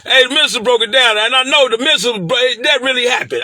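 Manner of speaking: hyped up, voice climbing; excited. Real, not acted. Hyped telling viewers about the minister's speech, excited.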